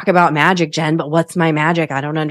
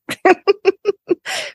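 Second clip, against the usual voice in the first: a high bright laugh bursting out; high burst of laughter. Real, not acted. Recalling husband calling the joke not funny, high laugh.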